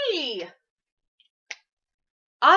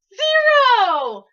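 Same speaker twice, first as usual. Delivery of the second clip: high, loud cheerful announce; excited reveal; 12 semitones higher, 11 dB louder than usual. Real, not acted. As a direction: sing out the number proudly.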